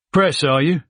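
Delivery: sizing up the journalist in Cockney; Cockney accent. Acted. Soldier asks Ross if press, Cockney accent. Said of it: "in a Cockney accent".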